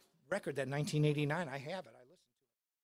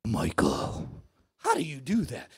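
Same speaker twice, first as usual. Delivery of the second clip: very low, loud, imposing voice; deep mock-intimidating impression; 5 semitones lower, 6 dB louder than usual. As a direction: question him like a menacing rock god. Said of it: "he says to me in this voice".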